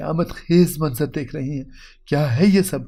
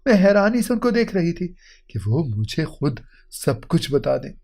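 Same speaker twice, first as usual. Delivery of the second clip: plain reading voice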